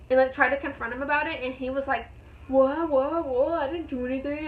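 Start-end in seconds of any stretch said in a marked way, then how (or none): 2.5-4.5 playing dumb, innocent what-what-what; lightly voiced clueless denial; mock denial. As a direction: imitate the driver's fake innocence. Driver denying he did anything.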